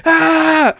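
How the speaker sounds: high-pitched mocking "aah"; mock scream. Ranter mocking bag defenders with a high scream. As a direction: cap the imitation with a high mock cry.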